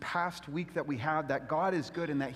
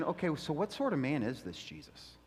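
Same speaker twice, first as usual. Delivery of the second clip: hushed question; 6 semitones lower, 6 dB quieter than usual. Retold or imitated. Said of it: "They're asking the question".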